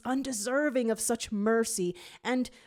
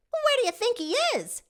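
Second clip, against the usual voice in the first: much higher, fast; quick eager question; high girl voice; 6 dB louder, faster than usual. A narrator's reading. Jade asks the squad, high and quick. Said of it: "asks Jade".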